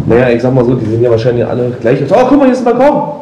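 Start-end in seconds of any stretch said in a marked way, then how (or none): 2.1-3.1 excited. Real, not acted. Excited find of a balcony.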